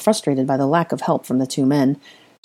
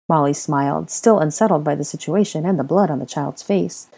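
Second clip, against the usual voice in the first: ordinary delivery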